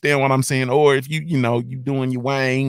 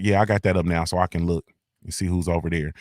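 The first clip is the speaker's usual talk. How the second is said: normal talking voice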